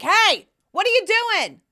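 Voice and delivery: high, loud mock holler; retold yell. Mom yelling at her son who changes clothes, mock shout. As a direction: call out as if across the house, re-enacted. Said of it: "I yell at him".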